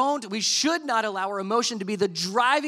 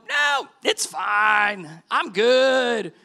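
strained, louder, slowed fake brush-off; mock denial; 2 semitones higher, 5 dB louder, much slower than usual. Hurt man waving off concerned onlookers, strained mock insistence. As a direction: insist you're fine through obvious pain. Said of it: "And you're like".

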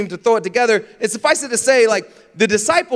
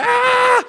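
very high acted scream; mock shriek of panic; panicked scream; 3 dB louder than usual. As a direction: scream as if sinking, hammed up. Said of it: "he starts to panic".

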